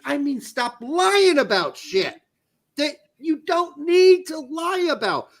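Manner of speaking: high, emphatic, drawn-out complaint; exasperated rant. Real, not acted. Host telling the coach to stop lying, exasperated rant. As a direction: hammer it out, pitch climbing in frustration. Ranting against the coach's lies.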